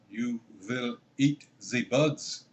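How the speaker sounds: put-on menacing German-accented decree, drawn out slowly; mock German accent. Host mocking Klaus Schwab's quote in a German accent. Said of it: "My attempt at a German accent".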